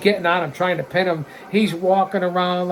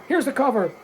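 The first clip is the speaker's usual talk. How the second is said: ordinary delivery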